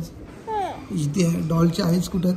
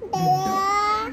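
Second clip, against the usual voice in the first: imitating the doll; much slower than usual. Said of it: "कशी बोलते?".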